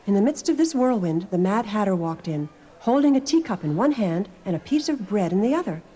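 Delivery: usual speaking voice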